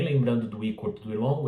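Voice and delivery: ordinary delivery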